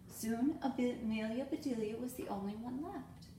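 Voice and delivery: ordinary delivery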